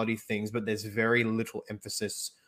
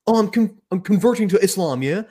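much higher, louder mocking impression of a braggart influencer; Andrew Tate; 10 semitones higher, 8 dB louder than usual. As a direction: ham it up, smug and loud. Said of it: "and he's like".

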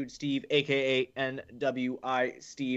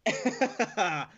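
laughing; 4 semitones higher than usual. Real, not acted. Laughing at cohost's beer story.

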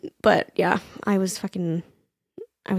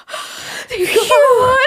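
huge relief. Retold or imitated. When the vet says yes, a high shouted cry of relief. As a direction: shout it like a weight just lifted. Vet finally takes the dog.

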